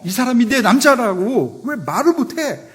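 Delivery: high, loud outraged question; furious demand. Retold or imitated. Drama hero scolding heroine outside the party, high furious shout. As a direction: blast the question at her in hammed-up rage. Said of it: "막 분노하면서".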